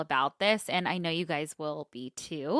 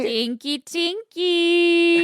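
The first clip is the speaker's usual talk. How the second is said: silly singsong; 9 semitones higher, 8 dB louder, much slower than usual. Pretend. Silly rhyme, drawn out.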